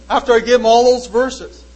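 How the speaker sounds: normal talking voice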